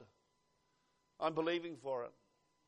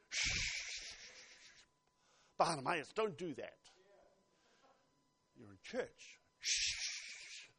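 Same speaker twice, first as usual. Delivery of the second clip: mock shushing; 3 semitones lower, 6 dB quieter, slower than usual. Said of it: "the people said to him".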